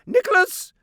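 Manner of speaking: shrill high scream of a name; screaming aunt. A narrator's reading. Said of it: "she screamed".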